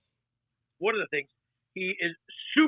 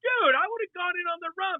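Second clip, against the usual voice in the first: high-pitched protest; 11 semitones higher than usual. Retold or imitated. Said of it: "I'm like".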